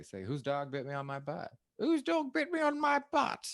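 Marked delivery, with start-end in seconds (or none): 1.8-3.5 high, posh-Queen English accent on the test line. Shown as a demonstration. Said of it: "say an English accent".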